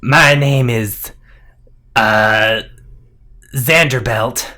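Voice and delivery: slow, loud creature voice; loud, hesitant serpent sentry; snake guard introduction. Acted. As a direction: announce your name haltingly as a monster.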